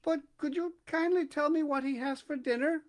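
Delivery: put-on small, high, polite young voice; a politely curious little elephant; polite elephant's child. A narrator's reading. Elephant's child politely asking the python snake.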